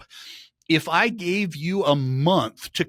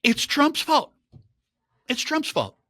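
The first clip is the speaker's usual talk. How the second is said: lightly voiced blame-shifting official, higher pitch; mock official excuse; 10 semitones higher than usual. Department of Labor blaming Trump for questioned data, mock excuse. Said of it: "They say".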